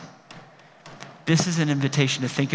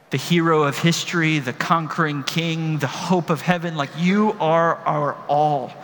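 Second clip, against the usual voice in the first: closing prayer. Acted. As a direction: pray it sincerely to the congregation.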